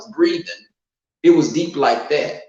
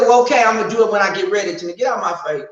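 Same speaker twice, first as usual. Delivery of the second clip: fast, higher retold retort; snapping him away, quick and raised; snappy; 6 semitones higher, 4 dB louder, faster than usual. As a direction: rattle off an impatient brush-off. Retold snappy reply to boyfriend.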